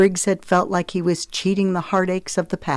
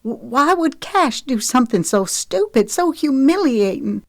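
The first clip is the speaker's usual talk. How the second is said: stammering, high and upset; stunned, stumbling on the first word; shocked disbelief; 8 semitones higher than usual. A narrator's reading.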